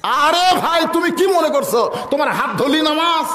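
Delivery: high, exclaiming retort; mock protest. Man protesting that a grabbed hand means prayer, high mock exasperation. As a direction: cry out in exaggerated exasperation. Acting a protest to nagged friend.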